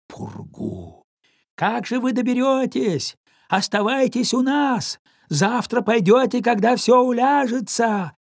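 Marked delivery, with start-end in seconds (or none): 1.6-8.1 shouting over wind. Acted. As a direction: call out over the howling wind. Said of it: "прокричал сквозь вой ветра".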